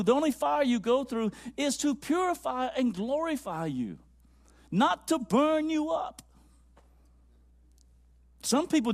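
high-pitched, impassioned pulpit delivery; fervent sermon exhortation; fervent preaching. Acted. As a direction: preach it high, stretching the key phrases. Preaches to congregation.

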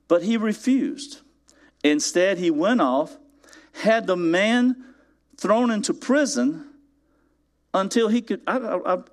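usual speaking voice

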